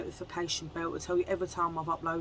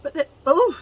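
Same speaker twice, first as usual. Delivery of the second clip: surprised; 8 semitones higher, 10 dB louder than usual. Real, not acted. Sudden exclamation at price.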